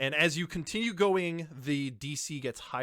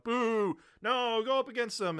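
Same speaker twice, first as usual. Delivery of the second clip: jeering crowd; 10 semitones higher than usual. Acted. Crowd jeers the newcomer for refusing, high mock boos. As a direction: heckle him like a rowdy bar crowd. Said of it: "some boos and jeers from the crowd".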